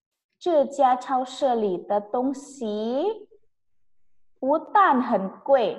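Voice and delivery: very slow, syllable by syllable; slow model reading. Shown as a demonstration. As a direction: dictate it slowly to the class. Teacher reads built sentence. (membaca sangat pelan)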